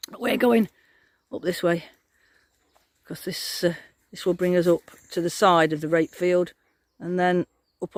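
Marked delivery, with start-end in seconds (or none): none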